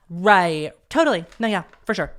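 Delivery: loud sarcastic agreement; mock agreement, dripping irony. Real, not acted. Laughing at her own failed college plan, loud sarcasm.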